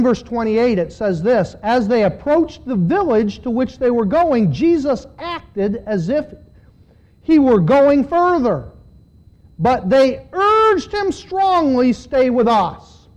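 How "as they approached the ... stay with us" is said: loud, high, slowing scripture read; dramatic scripture reading. Acted. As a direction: proclaim the verse, drawing out the plea. Reads scripture with force.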